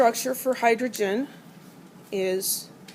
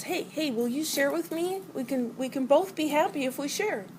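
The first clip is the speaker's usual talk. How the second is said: higher, pleading little voice; coaxing oxygen voice; 6 semitones higher than usual. Acted. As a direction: sweet-talk the hydrogen into sharing. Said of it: "And the oxygen says".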